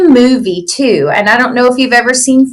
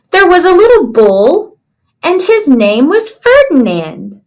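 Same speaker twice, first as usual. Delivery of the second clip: storybook reading. Acted. Teacher reads picture book aloud.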